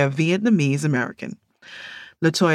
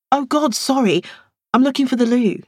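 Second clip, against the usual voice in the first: higher, startled and apologetic; flustered apology; 9 semitones higher, 4 dB louder than usual. Acted. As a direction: blurt it out embarrassed. Dara walks in on someone.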